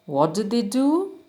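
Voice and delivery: normal talking voice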